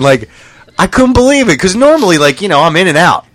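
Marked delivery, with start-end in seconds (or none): none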